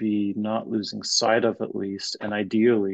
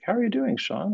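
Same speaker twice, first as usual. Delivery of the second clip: supervisor's quick, much higher check-in; casual greeting; 11 semitones higher than usual. Retold or imitated. Supervisor casually greets her trainee, high and light. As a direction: toss off a breezy how-are-you. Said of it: "and she's like".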